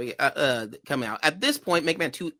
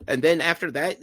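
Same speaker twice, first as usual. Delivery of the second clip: ordinary delivery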